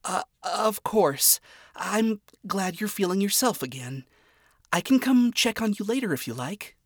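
hide the hurt behind politeness; mild disappointment. Acted. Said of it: "disappointment in her own voice".

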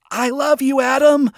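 high, lightly voiced gushing fan; fangirl adoration. A narrator's reading. Adoring fan declares love to Adam, gushing. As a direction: squeal it a little like a smitten fan.

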